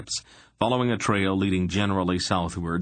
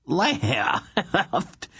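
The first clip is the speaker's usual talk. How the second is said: high outraged Left?; loud objection; 11 semitones higher than usual. A narrator's reading. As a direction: shoot the word up in disbelief. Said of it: "Besher objected loudly".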